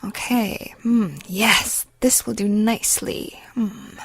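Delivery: soft, leering mutter to himself; creepy mumbling. A narrator's reading. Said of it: "nodding and mumbling to himself".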